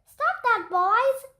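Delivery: high, loud repeated scold ringing back; echo yelling back. Acted. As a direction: echo it off the walls. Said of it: "yelled the gym".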